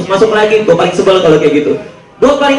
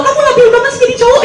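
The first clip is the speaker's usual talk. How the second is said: high, fast falsetto nag; fast mock girlfriend; faster than usual. Girlfriend scolding her boyfriend, in high fast falsetto. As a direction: rattle it off like a fussy girlfriend.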